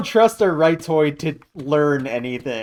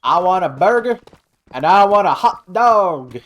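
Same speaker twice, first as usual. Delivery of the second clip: mock American grill guy; 4 semitones higher than usual. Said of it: "I don't know what accent I'm doing".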